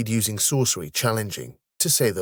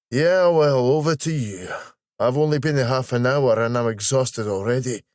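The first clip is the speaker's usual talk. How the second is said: raised Scottish voice, loud at first; bow out jokingly in broad Scots; playful Scottish grumble; jokey Scottish colleague; 3 semitones higher, 4 dB louder than usual. Acted.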